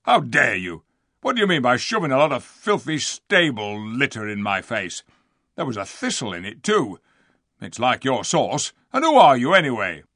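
loud, blustering rustic dwarf; outraged dwarf. A narrator's reading. Diggle railing at Lucy over the flowers, outraged. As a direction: rant indignantly at the intruder.

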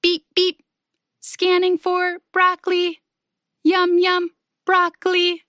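monotone robot with evenly spaced words; be a scanning robot, all on one pitch; pretend robot voice. A narrator's reading. Friends taking turns as broccoli robots, playful robot voice. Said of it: "pretending to be broccoli robots".

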